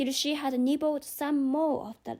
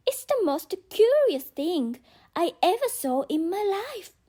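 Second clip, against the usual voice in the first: higher and louder, wide-eyed wonder; amazed; 6 semitones higher, 4 dB louder than usual. Acted. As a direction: marvel at the floating grin. Alice marvels at the grin.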